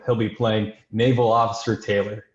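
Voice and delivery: usual speaking voice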